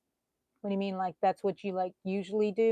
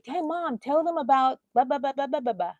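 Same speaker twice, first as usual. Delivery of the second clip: put-on eager kids begging; 6 semitones higher than usual. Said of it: "my kids ... they say".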